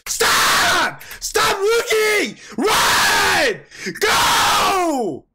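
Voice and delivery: high, loud, stretched-out screams; screamed commands. Real, not acted. Viewer ordering an anime hero to stop looking and run, screamed commands. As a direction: scream each order desperately.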